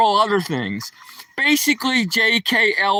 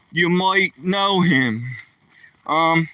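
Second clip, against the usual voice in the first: ordinary talk, nothing marked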